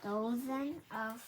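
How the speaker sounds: plain reading voice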